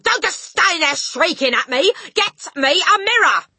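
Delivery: loud, high-pitched impatient yell; angry yelled order. A narrator's reading. Father to shrieking wife, impatient yell. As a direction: bellow at her to hurry. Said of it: "the father yelled".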